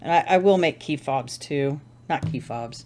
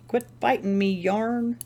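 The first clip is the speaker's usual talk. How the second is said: raised-pitch mock telling-off; mock scolding; 7 semitones higher than usual. Crafter scolds her tangled yarn, mock annoyed. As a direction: playfully scold in a higher pitch.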